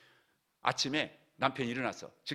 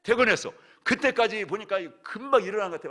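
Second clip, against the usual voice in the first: exclaiming loudly; 12 semitones higher, 14 dB louder than usual. Real, not acted. Story climax, preacher exclaims.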